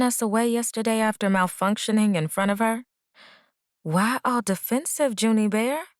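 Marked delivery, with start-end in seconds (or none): 3.8-5.9 teasing, higher pitched quip; quipping. A narrator's reading. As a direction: tease her playfully. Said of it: "she quipped".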